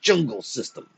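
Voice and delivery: indignant exclamation; a touch of explosive scorn; exploding. A narrator's reading. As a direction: throw it back in outrage. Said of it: "exploded his sire".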